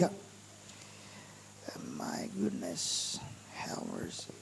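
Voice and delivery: breathy whispered aside to himself, under his breath. Real, not acted. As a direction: mutter it privately in a whisper, off-mic. Aside while searching for scripture. (గుసగుసగా తనలో తాను)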